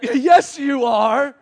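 high, loud mock retort; childish insistence. Us arguing back at God, loud childish mock insistence. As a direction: insist like a stubborn kid.

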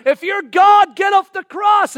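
loud, high taunt; jeering shout. Acted. Onlooker to Jesus on the cross, shouting a taunt. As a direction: yell it up at the condemned man.

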